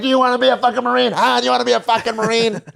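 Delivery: high, loud, fast barked question; drill instructor yell. Retold or imitated. Vietnam-era drill instructor screaming at recruit, high and fast.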